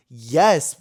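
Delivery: a loud, overeager "Yes."; loud eager yes. Pretend. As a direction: blurt "Yes." out like you can't contain yourself. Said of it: "I didn't say it like that".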